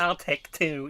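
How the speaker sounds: a hint of a perky cheerleader flipping her hair; lightly mock the line as a cheerleader. Mocking the trailer line to a friend, light cheerleader voice. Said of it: "flipping her hair in a cheerleader uniform and went".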